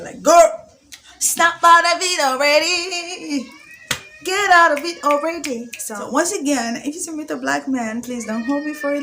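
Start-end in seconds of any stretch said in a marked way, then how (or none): 0.2-5.7 much higher and louder, slow sing-song scolding; sassy inner voice. Retold or imitated. Her inner voice telling her to get over him, sassy scolding. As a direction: tell yourself off like a sassy best friend. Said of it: "something was getting into my thick skull".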